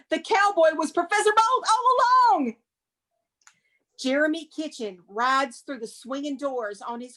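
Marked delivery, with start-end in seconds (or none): 0.1-2.6 high, loud announcer reveal; hammed-up twist announcement; dramatic twist reveal. A narrator's reading. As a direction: hit the twist with a big flourish.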